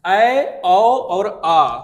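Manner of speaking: loud and very slow ऐ, औ, आ with mouth wide open; open-mouth demo. Shown as a demonstration. Teacher loudly demonstrating open vowels to the class. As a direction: open wide and belt out ऐ, औ and आ slowly. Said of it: "देखो पूरा बड़ा हो रहा है ना मुंह".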